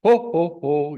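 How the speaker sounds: poked and startled; surprised ho. Shown as a demonstration. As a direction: jump as if someone poked you. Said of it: "you're surprised".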